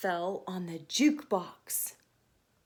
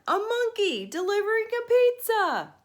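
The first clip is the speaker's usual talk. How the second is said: much higher, louder amazed shout; guard's shout; 10 semitones higher, 8 dB louder than usual. Acted. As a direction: call out in amazement.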